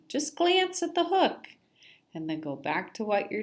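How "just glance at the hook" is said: squeaky high fish voice. Acted. Fish voices teasing lines.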